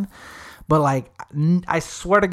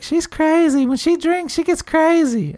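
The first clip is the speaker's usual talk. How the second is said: high excited quote; 12 semitones higher, 3 dB louder than usual. Retold or imitated. Friend warning about drunk girl.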